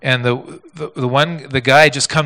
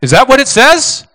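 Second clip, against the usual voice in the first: louder challenge; 12 semitones higher, 10 dB louder than usual. Shown as a demonstration. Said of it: "Wait a minute".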